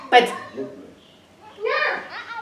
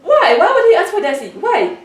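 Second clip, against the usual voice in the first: loud indignant why; 6 semitones higher, 5 dB louder than usual. Real, not acted. Outraged he wants the child.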